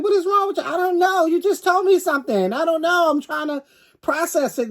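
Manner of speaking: hammed-up weepy high voice; mock crying sister. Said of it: "she started crying".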